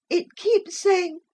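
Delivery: put-on high slow child voice; a frightened boy confiding; scared little boy. A narrator's reading.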